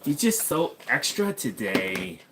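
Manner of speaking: slow, drawn-out flamboyant gossip. Pretend. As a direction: camp it up, slow and lilting. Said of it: "in a flamboyant voice".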